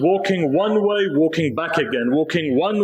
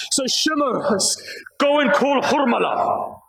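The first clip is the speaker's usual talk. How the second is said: higher, pleading, grief-stricken recital; rising lament; 5 semitones higher than usual. Real, not acted. Preacher reaching the baby's death for mourners, higher and lamenting. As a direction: lift the voice as the grief builds. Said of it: "If I can recite".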